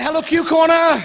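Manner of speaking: loud, high cheerful shout; shouted greeting. Pretend. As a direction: shout hello to the camera. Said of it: "I don't think I was quite loud enough".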